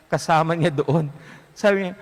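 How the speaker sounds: ordinary delivery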